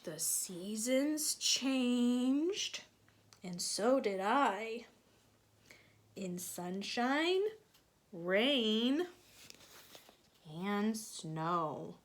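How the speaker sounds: slow, lilting storybook reading; reading to children. A narrator's reading. Teacher reading a picture book to children, lilting storybook voice. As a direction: read it gently to the kids.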